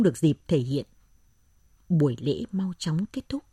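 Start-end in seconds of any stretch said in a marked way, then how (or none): none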